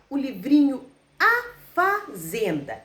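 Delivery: usual speaking voice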